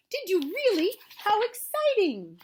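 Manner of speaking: high, loud, amazed reaction; thrilled, high-pitched exclamation; excited child character. A narrator's reading. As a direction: gush with drawn-out delight. Christopher Robin reacts with excitement.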